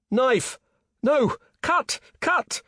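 very high, separate urgent shouts; frantic cries. A narrator's reading. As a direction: cry out single words in a frantic rescue. Frantic rescuers calling out.

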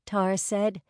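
plain reading voice

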